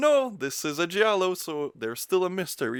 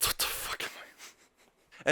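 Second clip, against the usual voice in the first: slow, breathless aside whispered to yourself; 7 dB quieter, slower than usual. Real, not acted. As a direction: whisper it in disbelief, slowly. Aside about oyster fingering.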